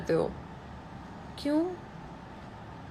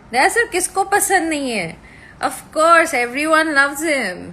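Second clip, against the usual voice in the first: excited gush; 5 semitones higher, 5 dB louder than usual. Real, not acted. Gushing about Daya sir.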